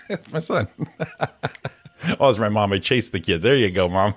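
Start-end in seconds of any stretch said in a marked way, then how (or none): 0.0-0.8 soft laugh through the words. Retold or imitated. Son retells mom's answer, laughing softly. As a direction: giggle as you say it.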